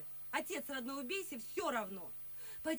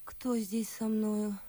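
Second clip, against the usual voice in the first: normal talking voice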